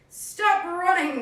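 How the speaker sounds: slow, loud horse call; neighing horse. Acted. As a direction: stretch it like a neigh. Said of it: "neighed the horse".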